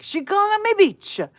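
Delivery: high, loud mock-whiny shout; yelled reply. Answering the friend's greeting shout in the store, high and loud. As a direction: yell the inside joke back. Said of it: "And I would yell back".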